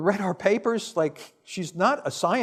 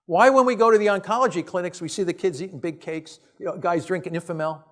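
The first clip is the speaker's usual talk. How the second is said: normal talking voice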